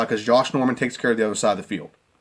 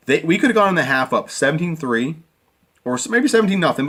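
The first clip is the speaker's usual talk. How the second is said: ordinary talk, nothing marked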